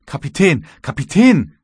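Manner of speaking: high, loud urgent cry; frantic calling out. A narrator's reading. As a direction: call for the captain, beside yourself. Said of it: "rief er". (aufgeregter, hoher Ruf)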